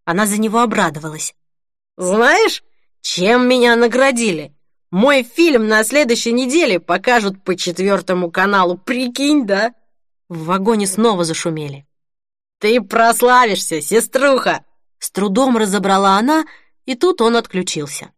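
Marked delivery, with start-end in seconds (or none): 2.0-9.7 excited. A narrator's reading. Excited drunk brother. 12.6-14.6 loud. A narrator's reading. Shouting over train noise.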